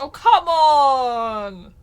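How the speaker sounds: long, drawn-out loud plea; loud protest. Real, not acted. Friend urging player to do vampire accents, loud protest. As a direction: protest loudly, stretching it right out.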